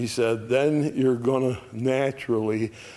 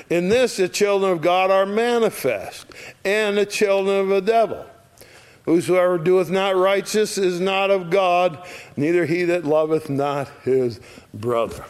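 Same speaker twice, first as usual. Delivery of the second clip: raised, declaiming Bible reading; higher, louder preacher's reading of the verse; scripture reading; 6 semitones higher, 4 dB louder than usual. Acted. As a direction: proclaim the verse to the congregation. Reads scripture aloud.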